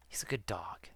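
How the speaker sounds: soft whispered praise for a beloved dog; tender whisper. Real, not acted. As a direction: whisper it lovingly. Tenderly praising her old dog.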